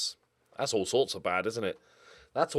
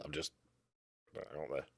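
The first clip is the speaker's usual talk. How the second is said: whisper; 8 semitones lower, 16 dB quieter than usual. Real, not acted. Trailing quiet remark.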